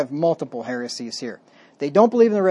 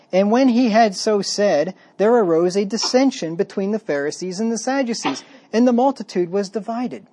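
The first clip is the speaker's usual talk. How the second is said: scripture reading; 3 semitones higher than usual. Acted. Preacher reads the verse to the church, raised reading voice. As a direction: read the verse out to the congregation.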